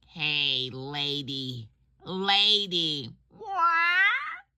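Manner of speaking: high squawky parrot calling out. Acted. Parrot calling after the lady before its punchline, high squawky voice. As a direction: squawk for her attention, rising to a shrill what. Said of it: "the parrot says to her".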